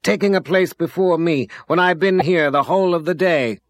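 put-on high, loud affronted old woman; indignant complaint. A narrator's reading. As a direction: scold him for cutting in. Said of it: "she snapped".

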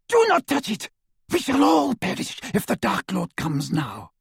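high, urgent, fearful; alarmed witch. A narrator's reading. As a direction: warn in desperate alarm. Bellatrix in alarm.

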